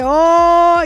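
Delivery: loud, high, drawn-out mock "oh"; imitated "oh" reaction. Imitating friend reacting to her joke, loud mock "oh". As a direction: imitate a big "ohhh" after a burn. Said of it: "pero él le hace".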